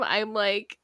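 ordinary talk, nothing marked

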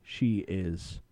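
normal talking voice

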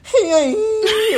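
high wailing mock howl of a dog, with laughter; dog's howl imitated. Retelling the dog howling in her face, high mock wail. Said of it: "she literally goes".

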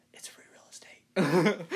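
slow whispered meme line. Retold or imitated. Quoting the video's line to friends, slow whisper. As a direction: breathe it out slowly in a whisper. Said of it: "that video where he's like".

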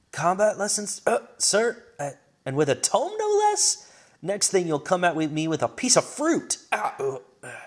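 put-on high, playful girl dodging blows; cheeky high girl's voice, out of breath; teasing young girl. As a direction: tease her old master while fighting. Foria teasing mid-fight.

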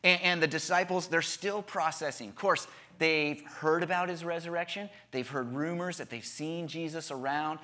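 normal talking voice

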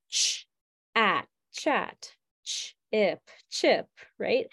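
Ch, at, chat and ch, ip, chip each sound said apart then blended; sounding out words. Shown as a demonstration. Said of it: "let's blend the sounds together".

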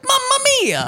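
high squeaky Italian plumber cry; cartoon Italian exclamation; Mario impression. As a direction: yelp it like Mario getting hit. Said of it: "he would go like".

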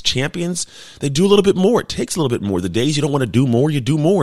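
retelling the coach's pep talk, hyped; fired-up coach. Retold or imitated. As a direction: pump the players up like a coach. Quoting coach's motivational speech.

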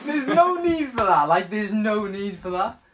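loud, high, drawn-out protest; mock protest. Co-host objecting to a crude joke, mock protest. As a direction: protest it loudly, stretching the words.

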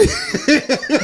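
high-pitched burst of laughter. Real, not acted. Laughs at own joke. (risa aguda)

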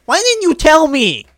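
high, loud and slow demand; hurt and indignant, high and loud; loud slow why didn't you tell me; upset demand. Acted. Voicing Hamilton upset.

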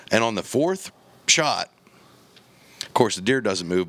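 normal talking voice

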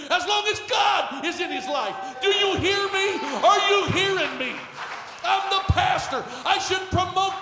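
very loud, high, fervent pulpit shout; shouted preaching climax. Real, not acted. Pastor testifying about the son to the church, fervent shouting. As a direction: cry it out over the whole congregation.

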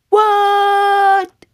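high, loud, stretched-out shriek; shocked parent bears. Acted. Mama and Papa Bear hear the news, shocked shriek. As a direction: cry out in hammed-up disbelief. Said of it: "And Mama and Papa Bear say".

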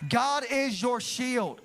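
slowly and deliberately, as a blessing; slow declaration. Real, not acted. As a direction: proclaim it word by word over them. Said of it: "I release over you".

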